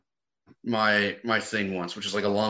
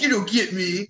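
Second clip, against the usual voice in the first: higher, louder teen whine in mock protest; angsty teenager; 8 semitones higher, 5 dB louder than usual. Teasing the host about his younger self, angsty teenager. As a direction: play a misunderstood teen. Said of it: "Like an angsty teenager".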